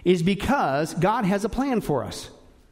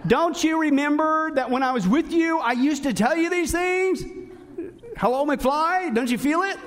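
pitched up, teasing reproach; sarcastic; 7 semitones higher than usual. Retold or imitated. Paul chiding the church for forgetting, sarcastic and higher. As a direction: needle them for forgetting. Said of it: "I love Paul's sarcasm there".

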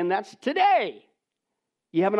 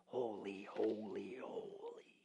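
each holy softer until it is only breathed; fading to a whisper; 9 semitones lower, 18 dB quieter, much slower than usual. Acted. Preacher echoing the refrain from Revelation, fading into a whisper. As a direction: let it die away into a whisper.

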